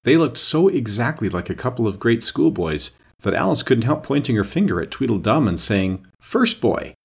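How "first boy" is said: schoolteacher call. A narrator's reading. Alice addressing the brothers.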